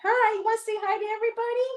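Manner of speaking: high-pitched, quick coo to a pet; sing-song talk to a pet. Real, not acted. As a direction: coax a shy pet in a high little voice. Cooing to a hiding pet.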